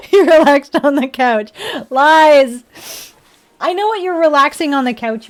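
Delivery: loud teasing accusation; mock accusing. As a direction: call the lie out playfully.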